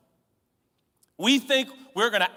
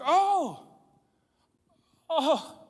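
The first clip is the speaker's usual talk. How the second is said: higher, gentle fatherly coo; doting dad; 7 semitones higher than usual. Retold or imitated. Preacher imitating his dad admiring his drawing. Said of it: "He'd be like".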